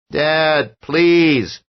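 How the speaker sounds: put-on high, loud desperate boy's plea; pleading child. A narrator's reading. Son begging frightened father, pleading child voice. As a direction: beg your father to stop.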